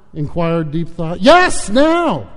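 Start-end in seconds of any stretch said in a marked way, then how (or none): 1.2-2.3 high, loud, urgent insistence; impatient shout. Acted. Both men demand the answer.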